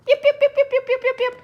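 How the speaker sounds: very high, loud yapping puppy; excited puppy yips. Acted. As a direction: yip like a wound-up puppy, with a laugh.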